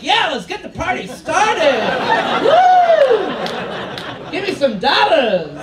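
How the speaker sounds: loud, high slow party cheer with a big woo; party whoop. Retold or imitated. Handcuffed by the bouncer, cheering loudly like at a party. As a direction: whoop like the party just started. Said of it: "I'm like".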